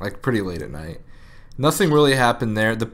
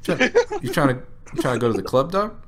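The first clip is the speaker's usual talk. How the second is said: put-on sing-song it like a drunk; mock drunk invitation; 8 semitones higher than usual. Retelling the drunk guy's offers, higher mock voice.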